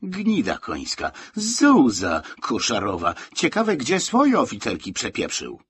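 acted bitter complaint; indignant grumbling. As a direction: curse the sergeant with rising indignation. Soldier's bitter rant about sergeant.

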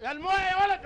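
high, loud call across the shop. Acted. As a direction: shout for the boy to bring water. Shopkeeper calls the boy for water. (نداء عالي للولد يجيب الموية)

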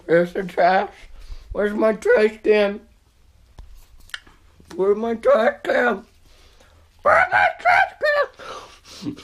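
rising panicky call for trash can; from worried to shrieking; building frantic call. Real, not acted.

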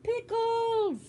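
high, loud delighted shout of the word; excited cry. Real, not acted. Telling viewers about pickle seasoning, a happy high shout. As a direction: cheer it like a favourite treat.

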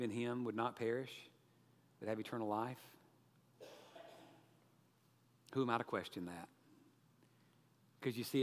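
low, soft, earnest pleading; gentle gospel appeal. Real, not acted. Preacher calling listeners to believe, hushed earnest appeal. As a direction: invite them quietly, with long pauses.

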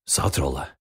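usual speaking voice

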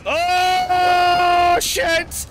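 high panicked yelling to run; frantic shriek, then urging to run; panicking scream. Real, not acted. Chased by dogs in game.

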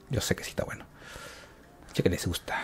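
voiceless, secretive whisper to the viewers; confiding whisper. Real, not acted. Gamer confiding to viewers that they like it, whispering. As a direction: lean in and whisper it.

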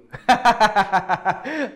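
bursting out laughing; big high laugh; loud laugh. Real, not acted. Laughs after Romanian bit.

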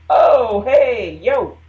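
loud startled call of hey yo. Retold or imitated. To the woman backing up near her towels, a loud hey yo. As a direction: shout a quick warning across the room.

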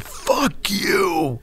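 sudden high angry retort; owner snapping back. Retold or imitated. Club owner shouting at the drummer, angry. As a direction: bite his head off. Owner refusing to let them leave.

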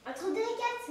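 surprised echo. Acted. Parent checks the child's guessing game, surprised. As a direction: echo it back as a surprised question.

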